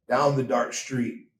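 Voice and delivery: ordinary delivery, nothing marked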